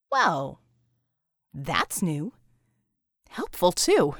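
low, dry surprised remark; wry heroine voice. Acted. Heroine reacts to her changed body.

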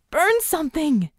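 high, urgent yell; high-pitched command. A narrator's reading. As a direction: shout it as a desperate order. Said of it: "she yelled".

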